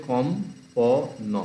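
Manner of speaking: কম, প, ন with a pause after each; syllable division. Shown as a demonstration. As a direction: break kompon into কম - প - ন. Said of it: "এইভাবে হচ্ছে syllable division".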